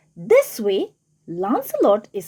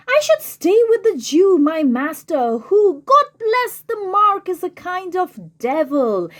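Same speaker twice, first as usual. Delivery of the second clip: Lancelot's decision; 6 semitones higher, 4 dB louder than usual. Acted. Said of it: "he's speaking to himself".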